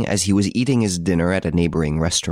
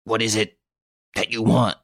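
fearful stammer; 4 semitones higher than usual. Acted. Victor asking the detective his demand, mildly fearful. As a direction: ask haltingly, afraid of the answer. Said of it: "stammered Victor fearfully".

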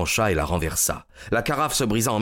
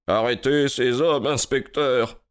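higher, strained, breathless command; gasping order; 5 semitones higher than usual. A narrator's reading. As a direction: gasp it out after being strangled. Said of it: "haleta-t-il". (ordre haletant, voix tendue)

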